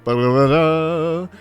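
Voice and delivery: usual speaking voice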